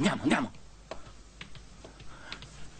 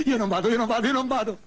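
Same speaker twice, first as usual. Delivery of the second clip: stubborn angry refusal; 7 semitones higher, faster than usual. Acted. Refuses the psychiatrist.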